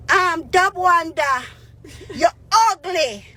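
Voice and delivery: high, slow mocking caller voice; mock caller voice with a laugh; caller's insult. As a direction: sneer it like a prank caller. Caller insults her on air.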